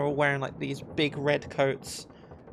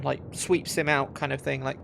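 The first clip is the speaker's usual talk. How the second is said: ordinary talk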